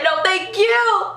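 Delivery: high, drawn-out fangirl gratitude; gushing thanks. Retold or imitated. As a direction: gush it slowly and high. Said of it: "I didn't say it like that".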